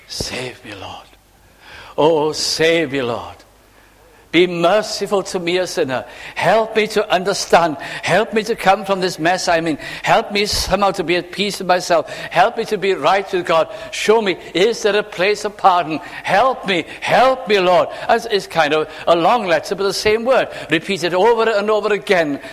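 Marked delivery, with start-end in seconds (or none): 0.1-3.4 pleading whisper. Acted. Sinner's prayer voiced. 4.3-17.9 higher, rising urgency; sinner's prayer building to a cry; urgent pleading. Acted.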